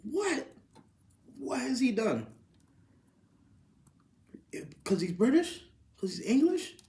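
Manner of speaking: high, stunned questions; can't believe what he's seeing; disbelieving outburst. Real, not acted. Ranting about transfer fee.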